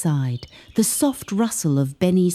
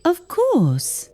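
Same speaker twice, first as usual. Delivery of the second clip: much higher, bright beaming of course; 11 semitones higher than usual. A narrator's reading. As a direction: answer glowing with happiness. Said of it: "Pip beamed".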